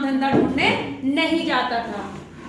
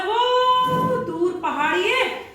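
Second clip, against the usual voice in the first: drawn-out pointing; 8 semitones higher, much slower than usual. A narrator's reading. Sage points to distant hill.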